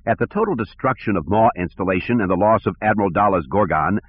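plain reading voice

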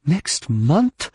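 can't believe what you heard; incredulous. A narrator's reading. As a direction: Leia hearing of a delay, incredulous.

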